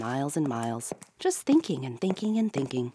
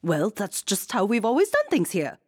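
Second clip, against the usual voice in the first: higher, louder offended bluster; offended queen blustering; 6 semitones higher, 5 dB louder than usual. A narrator's reading. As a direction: bluster defensively. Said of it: "blustered the queen".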